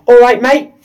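imitated Cockney greeting. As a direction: call it out like a Cockney postman. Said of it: "He's a Cockney".

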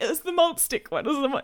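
high, whimpered retold explanation; mock sobbing. Caller still explaining malt sticks to the vet, mock sobbing. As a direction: whimper it like a comic meltdown. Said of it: "you're sobbing through a description".

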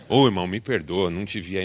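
ordinary talk

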